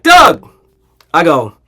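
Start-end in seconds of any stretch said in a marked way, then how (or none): 0.0-0.5 high, loud excited greeting. Retold or imitated. Golf pro greeting Doug, exaggerated excitement. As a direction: burst out with the name like an eager host. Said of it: "like, excited, like".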